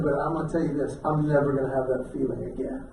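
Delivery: low, soft heartfelt apology; tender sincerity. Retold or imitated. Husband apologising to his wife that night, low tender sincerity.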